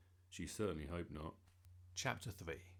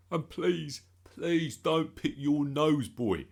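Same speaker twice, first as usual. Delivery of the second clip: loud, high, drawn-out plea; imploring dad; 8 semitones higher, 13 dB louder, slower than usual. A narrator's reading. Dad advising Bertie before meeting the Queen, imploring. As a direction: stretch the pleading words in a dad voice.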